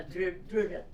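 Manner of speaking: ordinary delivery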